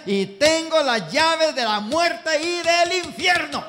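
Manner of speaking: high, loud, slow and stretched; solemn proclamation. Acted. Preacher voicing Christ's declaration, slow and booming. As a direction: proclaim each word.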